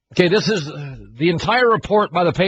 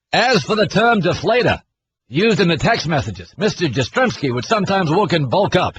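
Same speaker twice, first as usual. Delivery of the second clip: mock-reading in a Boston accent; hammed-up Boston accent. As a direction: read it like a Boston local. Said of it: "I wanna read this in a Boston accent".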